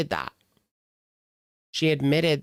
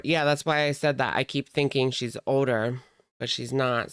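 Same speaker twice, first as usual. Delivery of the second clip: normal talking voice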